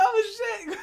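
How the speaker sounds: high loud oh shit; startled, almost crying out; a sudden high burst of alarm; shocked. Retold or imitated. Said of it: "I was like".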